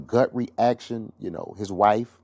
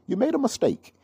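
much higher, mock British accent. Trevor Noah telling Will Smith gently, mock British accent. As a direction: jump up into a hammed-up British accent. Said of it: "he said it in his British accent".

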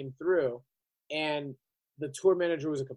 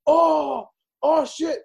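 much higher, louder alarmed "oh shit"; mock caught-out; 10 semitones higher, 6 dB louder, much slower than usual. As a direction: sound busted. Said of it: "And he goes".

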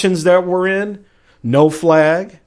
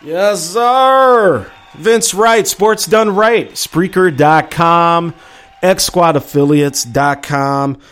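radio show promo; 3 semitones higher, 3 dB louder, slower than usual. Acted. Host plugging his show and sites, in announcer voice. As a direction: sell the show name like a station ID.

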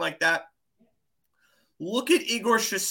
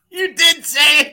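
laughing it out, much higher and louder; 8 semitones higher, 10 dB louder than usual. Real, not acted. Cohost catches host's name slip, laughing loudly. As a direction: burst out with delighted laughter at the gaffe.

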